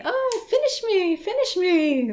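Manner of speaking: high, loud pleading whine; pleading sweater voice. Acted. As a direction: beg like an unfinished sweater.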